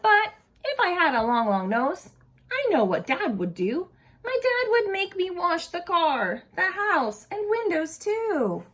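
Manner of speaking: lively storybook read-aloud voice; animated picture-book reading; reading to children. Acted. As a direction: read it to a group of kids with expression.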